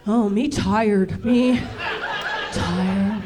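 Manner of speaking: low, slow, worn-out caveman; tired caveman. Acted. Caveman worn out after grunting, tired and slow. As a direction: let the caveman run out of steam. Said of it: "Like a caveman".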